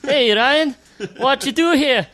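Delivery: put-on Scottish accent. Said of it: "you gotta do that in an Irish accent".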